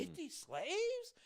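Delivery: high, drawn-out mock disbelief; astonished echo. Man hears he can buy fifty, amazed and high. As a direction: echo it slowly, pitch soaring in amazement.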